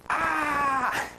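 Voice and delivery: high mock bear roar, sudden; startled bear roar. Bear surprised at the door, startled roar. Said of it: "there was this bear goes".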